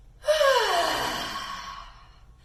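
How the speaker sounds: long loud breathy exhale out; big audible exhale. Shown as a demonstration. Teacher demonstrating a deep breath for the kids, loud exhale. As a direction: let the breath go with a loud sigh. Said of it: "take a deep breath".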